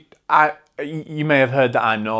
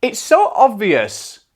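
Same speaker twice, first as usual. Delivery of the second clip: awed exclamation; 11 semitones higher, 4 dB louder, slower than usual. Retold or imitated. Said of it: "And I was like".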